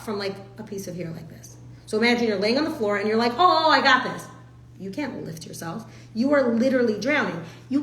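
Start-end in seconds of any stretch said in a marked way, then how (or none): 3.3-4.3 mock confident. Mock confidence while drowning.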